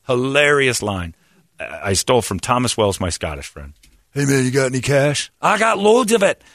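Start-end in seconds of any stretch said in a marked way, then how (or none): 4.1-5.3 put-on light Scottish accent, beggar's ask; a hint of a Scottish brogue. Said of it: "if you say it with a Scottish accent". 5.4-6.4 put-on high, drawn-out Scottish accent; Scottish accent punchline. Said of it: "if you say it with a Scottish accent".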